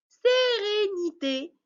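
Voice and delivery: "Sérénité" slow and high, each syllable stretched. Shown as a demonstration. Teacher showing where accents go, slow stretched syllables. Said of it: "il faut couper le mot par syllabe".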